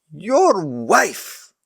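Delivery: hissed. Acted. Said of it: "Abimelech hissed".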